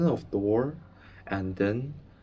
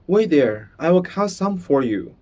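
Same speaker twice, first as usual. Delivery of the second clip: put-on eager boy; 6 semitones higher, 6 dB louder than usual. Bruno eager.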